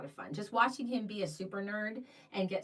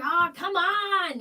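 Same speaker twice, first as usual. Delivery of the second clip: higher, louder, slow mock Scottish accent; Billy Boyd Scottish accent; 8 semitones higher, 9 dB louder, slower than usual. Actor urging her to join the tournament, mock Scottish accent. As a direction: coax in a hammed-up Scottish accent. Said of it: "in his Scottish accent".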